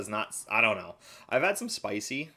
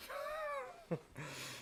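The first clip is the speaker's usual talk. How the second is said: high laugh; 11 dB quieter than usual. Real, not acted. Laughing after the story.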